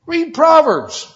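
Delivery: slow, high, loud stress on Proverbs; preaching climax. Acted. Climax of repeated exhortation.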